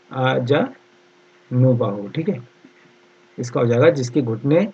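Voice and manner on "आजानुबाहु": very slow आजानुबाहु, split into parts; stretched out for someone writing it down; syllable-by-syllable dictation. Shown as a demonstration. Teacher dictating spelling slowly.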